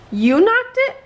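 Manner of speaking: high, loud disbelief; shocked question. A narrator's reading. Owlette stunned that Newton knocked the asteroid, high and loud. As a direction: gasp it out in disbelief. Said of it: "asked Owlette".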